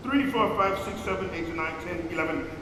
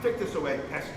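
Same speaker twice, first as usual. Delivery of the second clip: lower, softer command; low-key order; 6 semitones lower, 6 dB quieter, faster than usual. Acted. Master ordering pesky servant to clear, subdued. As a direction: give the order low, under the breath.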